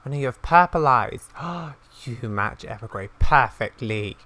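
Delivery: usual speaking voice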